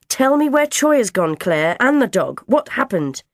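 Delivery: loud, high worried demand; acted agitated questioning; urgent questioning. A narrator's reading. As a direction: press for answers as an anxious mum. Mother demanding news of son.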